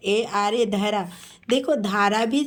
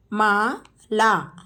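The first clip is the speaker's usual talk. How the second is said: Mala drawn out slowly; slow teacher model of Mala; much slower than usual. Shown as a demonstration. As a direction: stretch Mala syllable by syllable. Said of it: "teacher models name slowly".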